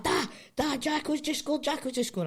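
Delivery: put-on high, eager kid calling his dad; imitating an excited wee boy shouting that a goal's gone in; excited son. Son shouting news of goal.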